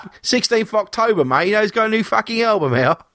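a rowdy English metal fan, mate; British bloke voice. Acted. Saxon fan announcing the new album, in a laddish English accent. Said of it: "who do you fucking think it is if I'm talking like this".

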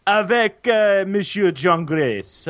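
slow, showy French introduction; French accent. Acted. Host introduces another co-host, put-on French accent. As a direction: announce a guest in mock French.

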